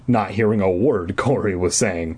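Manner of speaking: ordinary talk, nothing marked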